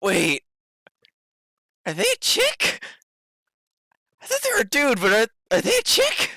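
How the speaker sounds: high, puzzled questioning; halting, high-pitched confusion; dawning realization. Acted. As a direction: squeak the question in mock bafflement. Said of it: "you are realizing".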